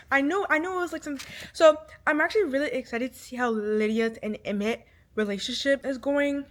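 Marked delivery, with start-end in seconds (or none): none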